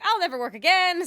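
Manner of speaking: hammed-up panic over never working again; mock despair. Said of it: "to being like".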